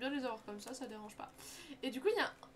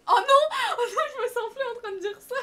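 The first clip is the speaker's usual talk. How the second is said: laughing; 11 semitones higher, 16 dB louder than usual. Real, not acted. Realizes she read chat aloud.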